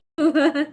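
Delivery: sudden loud laugh; burst of laughter. Real, not acted. A viewer teases her about her nose, she bursts out laughing. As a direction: laugh out at the teasing.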